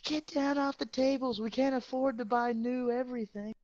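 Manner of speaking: high, loud nagging lady voice; mocking shrill administrator. Student imitating administrator ordering kids off tables, high nagging. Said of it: "she's like".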